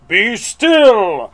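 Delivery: high, loud theatrical order; big drawn-out command; commanding shout. Acted. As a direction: shout it to freeze a crowd of monsters. Max commanding the monsters.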